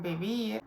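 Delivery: vivir with the stress on the final "-vir"; stress on last syllable. Shown as a demonstration. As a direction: hit the second syllable of "vivir". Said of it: "falls on the last syllable".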